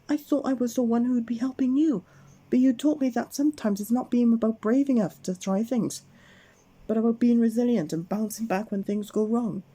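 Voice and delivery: high, heartfelt Miss Brave voice; grateful. Acted. Miss Brave thanking Mister Bump, high and grateful. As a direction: tell him what he taught you. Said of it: "smiled Miss Brave".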